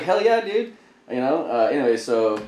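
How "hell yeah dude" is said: high, loud burst of retold enthusiasm; eager agreement. Young recruit telling his friend he is all in, excited. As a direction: jump in with an excited mock yell.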